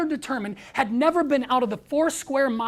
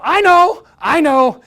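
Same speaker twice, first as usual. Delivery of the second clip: high, much louder retort; mock exclamation; 6 semitones higher, 12 dB louder, slower than usual. Mentor retelling his reply to a boy who flipped him off, mock exclamation. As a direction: exclaim it loudly, hammed up.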